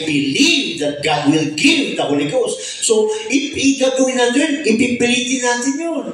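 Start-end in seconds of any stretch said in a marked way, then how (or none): none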